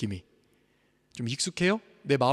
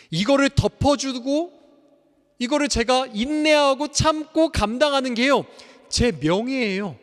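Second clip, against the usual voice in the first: slow, then higher and louder to a peak; passionate preaching; 8 semitones higher, 7 dB louder than usual. Acted. Pastor proclaiming a father's love, building to a shout. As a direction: build from earnest to triumphant.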